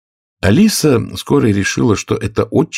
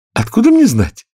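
put-on bold, higher-pitched girl's retort; 14 semitones higher, 4 dB louder than usual. A narrator's reading. Alice answers the queen, surprised at her own courage, bold and high. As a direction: answer back high, with sudden boldness. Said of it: "удивляясь своей смелости".

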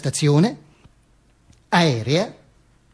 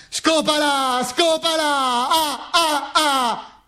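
higher, slow, mocking jeer; jeering crowd; 9 semitones higher, much slower than usual. The mob jeers at the Duce, high and mocking. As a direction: taunt him like a leering mob. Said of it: "mi incita".

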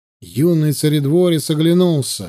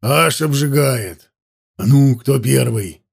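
lightly voiced hearty soldier; a touch of rowdy cheer; merry exclamation; 3 semitones lower than usual. A narrator's reading. Soldiers dare each other in cold water, merry. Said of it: "слышались весёлые возгласы".

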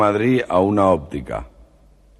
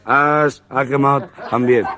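stutterer's voice; 6 semitones higher, slower than usual. Retold or imitated. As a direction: stammer on has, pitched higher. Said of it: "el tartamudo".

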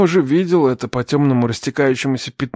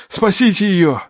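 high, desperate plea; almost begging; 6 semitones higher, 3 dB louder, slower than usual. A narrator's reading. Man hands the girl to doctors, almost begging. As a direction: implore them with raised voice. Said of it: "Я почти умолял".

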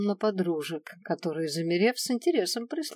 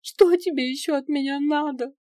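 high, distraught question; hurt, pitched-up despair; tearful plea; 9 semitones higher, 5 dB louder than usual. A narrator's reading. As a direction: plead on the edge of tears. Tearful plea after a sob.

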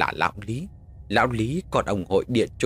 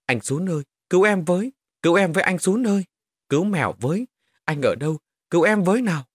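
put-on high, loud child's call for rescue; desperate cry for help; 8 semitones higher, 4 dB louder than usual. A narrator's reading. Child calling her big brother to save her, desperate high cries. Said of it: "bỗng nghe lên tiếng đứa bé kêu".

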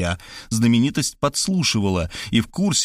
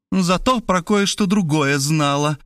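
slow, high, gloating announcement; triumphant; 7 semitones higher, slower than usual. A narrator's reading. Markhalyova driving Zaslavsky into a corner, triumphant. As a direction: savour the reveal. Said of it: "торжествуя сообщила Мархалёва".

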